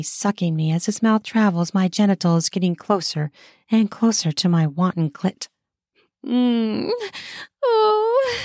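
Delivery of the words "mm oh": high-pitched moan of pleasure. A narrator's reading. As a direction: moan rising sharply in pitch. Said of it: "I moan loudly".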